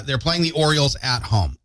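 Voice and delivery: ordinary delivery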